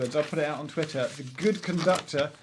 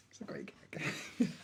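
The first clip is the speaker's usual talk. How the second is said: whisper; 11 dB quieter, slower than usual. Real, not acted. Aside after his own joke.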